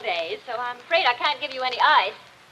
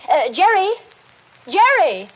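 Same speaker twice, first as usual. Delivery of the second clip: raised call across the garden; 9 semitones higher, 6 dB louder than usual. Acted. Wife calling her husband out the window, loud high call.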